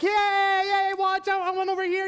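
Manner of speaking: high loud mock cheer; hammed-up fan yelling yay; cheering crowd. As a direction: fan at the roadside cheering the president. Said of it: "they were like".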